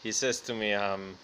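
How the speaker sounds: ordinary delivery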